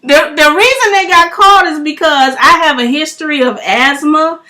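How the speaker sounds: loud, high, amused retelling; telling it with rising glee; excited retelling. Real, not acted. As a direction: friend overreacted from New York. Laughing retelling to friend.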